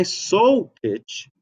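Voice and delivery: "so" lifted high. Shown as a demonstration. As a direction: pitch the word "so" up high to show the upper note. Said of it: "I was up here".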